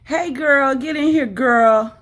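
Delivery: loud, high coaxing call; calling the dog. Real, not acted. Calling her dog into the room.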